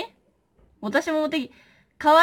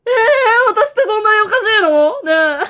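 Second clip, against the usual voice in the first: mock whining; 10 semitones higher, 11 dB louder than usual. After mom double-took her.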